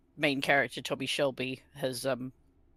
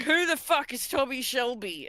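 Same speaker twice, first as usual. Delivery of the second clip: cocky soldier; 9 semitones higher than usual. Retold or imitated. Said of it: "the soldier is like".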